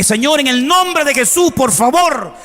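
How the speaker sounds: high, slow, urgent plea; desperate prayer. Retold or imitated. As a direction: cry out to God for help. Súplica a Dios por su hija. (súplica desesperada a Dios, en tono agudo)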